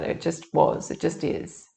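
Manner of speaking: whispered, fading away; soft whisper. Real, not acted. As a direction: breathe it out softly. Sincere point about nature's abundance.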